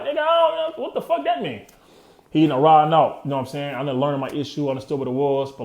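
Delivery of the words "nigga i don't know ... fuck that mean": high-pitched, disbelieving snap at the man; incredulous retort. Retold or imitated. Telling the young paralegal he doesn't understand, high and incredulous. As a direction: throw it back at him, baffled and high. Confused retort to angry lawyer.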